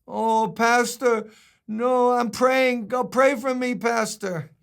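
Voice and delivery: high, slow pleading voice; street man's voice. Retold or imitated. Street man pleading with his pastor, high and slow. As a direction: plead like the street guy, drawn out. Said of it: "That's how he talked".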